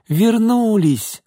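high, drawn-out cry of delight; little girl thrilled that they are back; overjoyed child. A narrator's reading. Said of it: "обрадовалась Алёнка". (радостный детский возглас)

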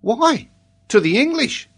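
put-on high, confident child's voice; matter-of-fact child. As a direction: answer as if it were quite settled. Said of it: "as if the fact was quite settled".

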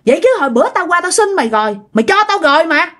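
high-pitched, defiant retort, acted out; arguing back. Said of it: "bà Sáu bà mới cự lại".

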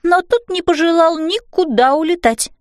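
usual speaking voice

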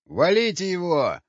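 high, urgent shouted order; echoing yell. A narrator's reading. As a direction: shout it to the others in a panic. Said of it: "вторил ему другой голос". (громкий крик-приказ)

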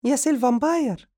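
very high, fast squeaky woman's voice; Madiha's voice. Acted. Madiha calling the fainted vampire, high and squeaky. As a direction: pipe it up high and quick like a silly housewife. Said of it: "وقفت مديحة في منتصف الغرفة وهي تقول".

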